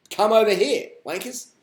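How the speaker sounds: high, loud beckoning; playful loud invite that drops off; playful call. Real, not acted. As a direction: call them over with a cheeky grin. Calling viewers over to Thailand.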